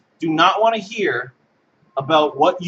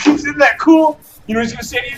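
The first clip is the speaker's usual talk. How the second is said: ordinary talk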